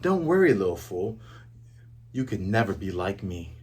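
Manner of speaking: low, soft sneer; sneering villain. Retold or imitated. Kuro mocking Luffy, low and soft sneer. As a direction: taunt him quietly from down low. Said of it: "Luffy says".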